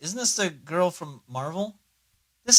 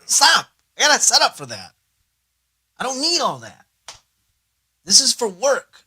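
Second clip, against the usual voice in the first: high, loud self-reprimand; scolding himself; 4 semitones higher, 4 dB louder than usual. Retold or imitated. Telling himself off about buying another laptop, loud and exasperated. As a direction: snap at yourself to stop overdoing it. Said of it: "I was like".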